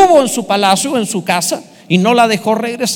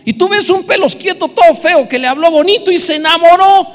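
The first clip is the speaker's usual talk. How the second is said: much higher and louder mock outrage; 8 semitones higher, 6 dB louder than usual. Preacher mocking the ugly suitor to the congregation, higher and louder. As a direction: exaggerate the disbelief for laughs.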